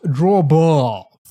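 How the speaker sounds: hammed-up, drawn-out English accent on "Draw balls"; put-on cartoon accent. Streamer doing the Family Guy guy, hammed-up English accent. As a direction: overdo the accent like a cartoon character. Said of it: "Why am I doing the accent".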